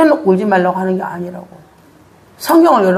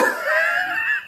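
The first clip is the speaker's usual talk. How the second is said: high laugh; 8 dB quieter than usual. Real, not acted. Laughs after "뚝!.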